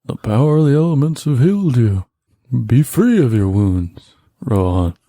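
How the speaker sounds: bestow the healing like a sage; lofty healer voice. Acted. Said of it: "Can you narrate for him all the time now?".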